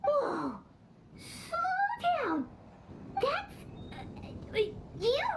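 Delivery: alarmed high yelp; startled. Acted. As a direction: jump in, flustered, to stop her. Startled by funeral sales pitch.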